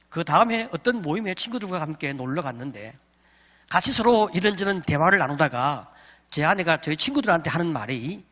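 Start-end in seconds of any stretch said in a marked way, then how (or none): none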